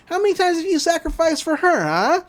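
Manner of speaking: normal talking voice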